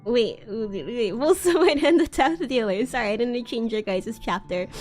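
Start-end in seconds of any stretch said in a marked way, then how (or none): none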